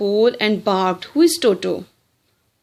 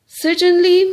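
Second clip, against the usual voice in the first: much higher and louder, cheerfully sure; scarecrow's answer; 9 semitones higher, 6 dB louder than usual. Acted. Teacher reading the scarecrow answering Dorothy, high and loud. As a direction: pop it out like a proud scarecrow.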